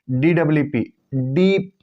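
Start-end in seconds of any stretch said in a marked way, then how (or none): 1.1-1.7 डीप with the ee stretched; long ee in deep. Shown as a demonstration. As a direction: hold the ee in डीप. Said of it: "ई का उच्चारण को लंबा खींचा". (डीप की ई लंबी खींचें)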